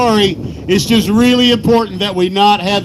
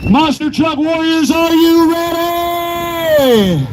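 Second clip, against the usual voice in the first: long drawn-out arena call; hype announcer; 5 semitones higher, slower than usual. Real, not acted. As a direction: stretch it out for the crowd. Announcer hyping the crowd.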